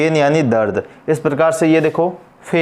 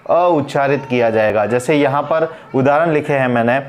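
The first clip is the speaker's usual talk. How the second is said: ordinary talk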